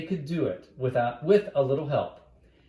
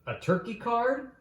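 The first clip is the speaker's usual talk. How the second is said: higher, sing-song tease; teasing little sister; 10 semitones higher than usual. Acted. Little sister teasing her brother, pitched high. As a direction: poke fun at him, lifting high.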